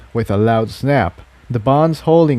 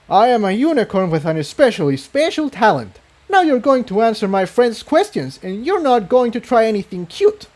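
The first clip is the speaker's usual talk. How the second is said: higher, fast and commanding; fiery unicorn; 10 semitones higher, faster than usual. Acted. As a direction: assert power over the captors.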